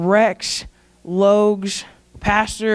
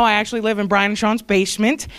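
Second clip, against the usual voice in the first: usual speaking voice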